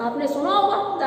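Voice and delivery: usual speaking voice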